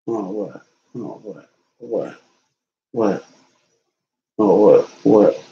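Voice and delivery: low, short questions in pet-talk; talking to a dog. Real, not acted. Owner asking the dog what it wants, low pet-talk. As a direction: drop your pitch and quiz the dog.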